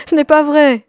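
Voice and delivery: high, loud protest; indignant cry. A narrator's reading. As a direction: cry out against the lie. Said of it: "cria Antonella".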